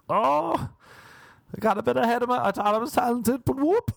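high-pitched mock dismay; hammed-up regret. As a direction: squeal it like a cartoon flop. Hammed-up self-mockery.

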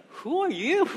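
jumps high on a startled "Who are you?". Retold or imitated. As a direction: sound suddenly woken and alarmed. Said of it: "He's startled".

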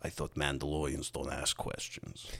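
lightly attempted German accent, low and soft; a low, quiet, half-hearted go at a German accent; understated mock-German accent, voice dropped; Werner Herzog. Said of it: "I can't do a Werner Herzog accent".

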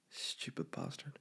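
a soft, unvoiced under-the-breath insult; muttered aside. Real, not acted. The host mutters an insult about a rival podcaster, under his breath. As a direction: mutter it nearly to yourself.

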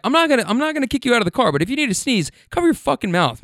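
high-pitched scolding, rushed at first; acted exasperation. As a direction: lay down the rule, fed up. Exasperated driver answers the passenger.

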